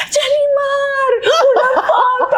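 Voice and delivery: very high, stretched squeal of a fan; excited fan. Retold or imitated. A fan at the mall calling out to her, high excited squeal.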